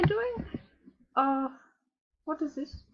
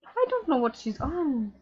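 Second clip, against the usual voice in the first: ordinary talk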